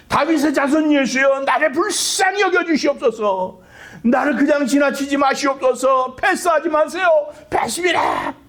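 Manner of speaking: high, strained cry for mercy; acted scream, pitched high and urgent; desperate screaming plea. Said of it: "이렇게 소리를 지르는 거, 비명을 지르는 거예요".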